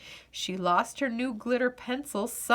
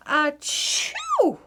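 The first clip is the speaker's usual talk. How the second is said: higher loud achoo; sneeze; 7 semitones higher, 4 dB louder than usual. A narrator's reading. Louise sneezing on Daddy's knee, loud sneeze. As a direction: burst into a sneeze.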